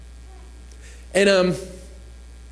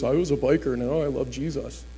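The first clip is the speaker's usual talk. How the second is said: imitating a big man's low voice; lightly mocking low impression; deeper-voiced biker; 4 semitones lower than usual. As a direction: drop into a low biker testimony voice. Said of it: "he had a deeper voice".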